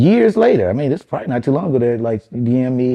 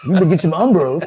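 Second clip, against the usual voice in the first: mock camper voice; 4 semitones higher than usual.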